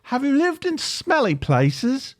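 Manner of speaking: high, drawn-out mock British accent; bad British accent. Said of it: "should have been said with a British accent".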